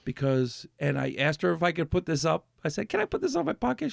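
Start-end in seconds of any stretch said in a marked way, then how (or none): 2.9-3.9 much higher, politely asking. Retold or imitated. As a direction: ask sweetly in a raised voice. Quoting himself asking lady.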